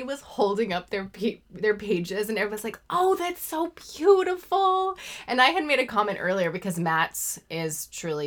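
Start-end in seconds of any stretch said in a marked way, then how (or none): 2.9-5.0 high, loud, drawn-out admiration; gushing praise. Retold or imitated. Colorers admiring each other's held-up pages, gushing. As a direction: coo it high and loud, stretching the words.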